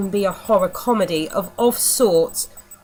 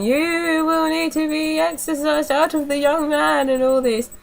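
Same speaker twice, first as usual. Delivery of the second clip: pompous vicar; 7 semitones higher than usual. Retold or imitated. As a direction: intone it like a vicar doing an exorcism. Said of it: "standing in front of him going".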